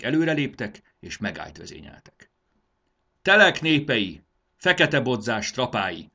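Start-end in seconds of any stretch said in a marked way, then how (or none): 3.2-6.1 loud, high proclamation; commander's ringing address; raised voice. A narrator's reading. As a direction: call out formally to the enemy ranks. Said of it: "emelte fel hangját Halisszad úr".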